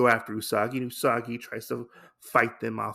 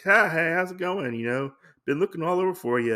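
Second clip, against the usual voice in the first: higher, louder acted hello; lively mock greeting; breezy greeting; 7 semitones higher, 4 dB louder, faster than usual. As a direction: hail a friend with raised pitch. Character greets friend, acted out.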